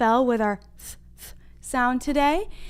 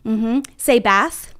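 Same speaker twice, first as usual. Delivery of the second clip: ordinary delivery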